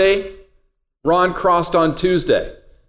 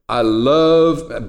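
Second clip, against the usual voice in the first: a hint of a deep soul singer; 3 semitones lower than usual.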